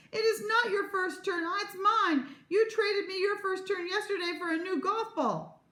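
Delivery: high-pitched outraged protest; high, heated quarrelling; indignant yelling. A narrator's reading. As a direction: yell it like a squabbling child.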